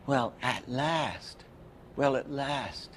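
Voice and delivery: put-on soft, slow little-kid "Well, at last"; lightly voiced child, drawn out; naive little boy voice. Shown as a demonstration. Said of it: "if you were gonna say".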